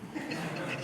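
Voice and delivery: breathy little laugh; soft laugh at his own joke; unvoiced chuckle; quiet laugh. Real, not acted.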